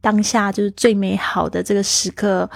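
normal talking voice

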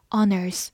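"on earth" run together as one, the n flowing into earth; linked on earth. Shown as a demonstration. Teacher showing the linking in on earth. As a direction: link "on" straight into "earth". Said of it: "on和earth之间有个连读".

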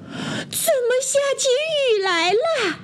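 very high, thin-volume cry of surprise; ant's mock shout. A narrator's reading. Ant woken by the bear's tears, high mock yell. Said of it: "大声地喊道".

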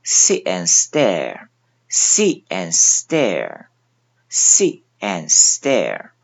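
sit and stare with the t and d silent, only the mouth shape; 吞音示范; swallowed t and d. Shown as a demonstration. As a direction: leave out the t of sit and the d of and. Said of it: "都只做出动作".

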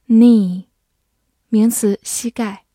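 normal talking voice

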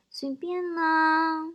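very slow, high, soft drawn-out coo; coquettish baby talk. Acted. Wife answers husband asking about dinner, coquettish cooing. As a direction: act cute and drag it out. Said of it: "很嗲声嗲气的说".

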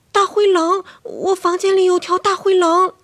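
high, loud cry of alarm; urgent high-pitched warning; alarmed child. Acted. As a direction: raise the alarm like a scared child. Frightened child tells mother of wolf.

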